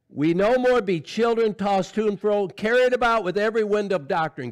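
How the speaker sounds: loud, high, forceful preaching; full-force preaching. Acted. Preacher urging the church to grow up, full-force preaching. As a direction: drive it home to the congregation at full volume.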